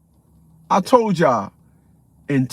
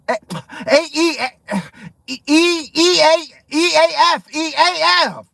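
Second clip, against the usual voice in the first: mocking nonsense spelling; 5 semitones higher than usual. Mimicking suspect spelling name.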